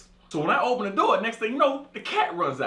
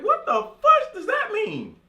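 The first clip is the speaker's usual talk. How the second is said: shocked disbelief; slower than usual. Retold or imitated. Shocked reaction to friends' request.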